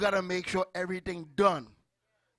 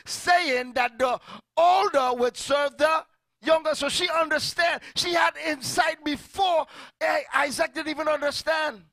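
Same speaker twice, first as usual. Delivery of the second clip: preaching at full force; 8 semitones higher, 8 dB louder than usual. Acted. Preacher shouting to congregation about Rebekah's insight. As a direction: preach it to the back row.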